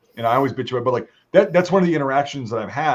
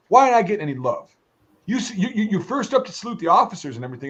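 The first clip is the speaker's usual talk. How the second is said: mock indignant sergeant; 6 semitones higher than usual. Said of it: "He goes".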